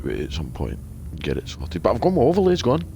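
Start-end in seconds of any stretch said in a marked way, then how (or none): 1.8-2.8 high, loud burst of excitement; excited yell. Real, not acted. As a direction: shout it out, thrilled. Excited about new stream overlays.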